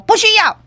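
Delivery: 不需要 snapped out high and firm; firm refusal. Retold or imitated. Store manager refusing the husband's demand, firm stress on 不需要. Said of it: "就强调讲".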